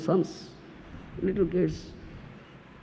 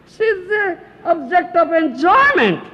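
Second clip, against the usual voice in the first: higher, louder smug husband; mock gruhamedhi voice; 9 semitones higher, 6 dB louder than usual. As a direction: sneer it as the attached man. Voices attached man's view.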